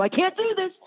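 high-pitched mock wail of defeat; hammed-up frustrated outburst; retold exasperation. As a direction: act out giving up, voice shooting up high. Retelling her frustrated outburst mid-task.

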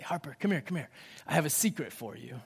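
put-on soft and low, leaning in to a child; a playful secret tone; secret-sharing hush. Calling daughter for a secret.

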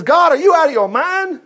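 high, incredulous acted outburst; mock exasperation. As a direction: question God in hammed-up disbelief. Paraphrasing Moses' outburst to God.

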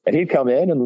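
ordinary talk

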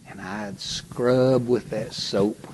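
ordinary talk, nothing marked

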